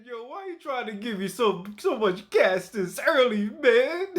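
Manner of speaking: high, mocking imitation of the guy; mimicking the guy. Streamer mocking the guy's greeting in a high voice. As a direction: quote him in a squeaky voice, mocking.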